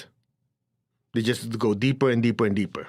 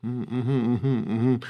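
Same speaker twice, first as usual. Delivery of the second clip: absent-minded murmur; 3 semitones lower, much slower than usual. Retold or imitated. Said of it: "act like you're present".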